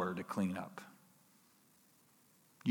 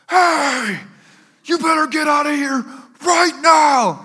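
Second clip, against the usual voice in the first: loud, high full shout; shouted warning, much louder and higher; intimidating yell; 11 dB louder than usual. Retold or imitated. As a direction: holler it down at an intruder. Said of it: "yell down super intimidating things".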